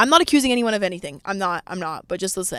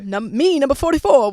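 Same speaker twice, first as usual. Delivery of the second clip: louder, higher mock TikTok voice; TikTok quote; 5 semitones higher, 5 dB louder than usual. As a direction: imitate a viral TikTok line, punching it out. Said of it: "whatever the fuck that TikTok is".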